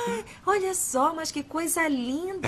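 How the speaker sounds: slow, high admiring voice; delighted. Acted. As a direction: coo over the gift. Delighted by gift.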